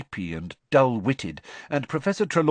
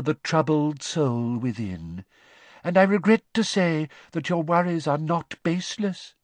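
mournful; 5 semitones higher than usual. A narrator's reading. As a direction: intone it like a tragic prophet. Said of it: "she said mournfully".

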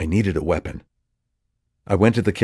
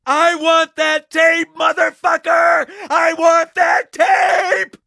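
high, slow, screaming fury; shrieking rage; 6 dB louder, much slower than usual. Acted. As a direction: shriek it like a war cry. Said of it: "voice like an erupting volcano".

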